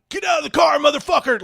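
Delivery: high, loud barked command; police officers shouting at the driver; cops screaming. Retold or imitated. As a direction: yell it like a cop with guns drawn. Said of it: "like, screaming at me".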